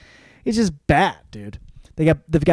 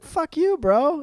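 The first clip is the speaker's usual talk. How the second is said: much higher squeaky brush-off; mock dismissal; 14 semitones higher, slower than usual. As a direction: squeak it out mockingly. Boss rejecting Jurgis.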